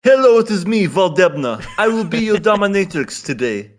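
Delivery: high, loud mock Russian dominatrix accent; hammed-up Russian dominatrix accent, commanding. Imagining the misspelt name as a Russian dominatrix, high and loud in a mock Russian accent. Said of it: "some Russian girl, like, dominatrix girl".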